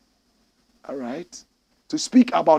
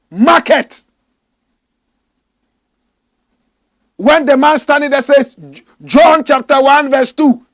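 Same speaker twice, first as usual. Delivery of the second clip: loud, high preaching shout; fiery rally shout; 11 semitones higher, 13 dB louder than usual. Real, not acted. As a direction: rouse the crowd like at a rally.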